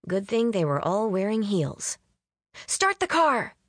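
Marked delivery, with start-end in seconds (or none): 2.7-3.5 yelling. A narrator's reading. Faye flees angry bridesmaids to her driver, yelling. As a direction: yell it to get moving, pitch way up. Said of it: "Faye yelled at the driver".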